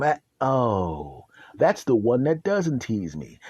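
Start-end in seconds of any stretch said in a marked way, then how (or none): none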